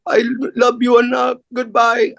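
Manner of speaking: high, drawn-out mock weeping plea; imitated tearful goodbye. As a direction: imitate a crying mother saying farewell, high and slow. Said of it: "and she's crying".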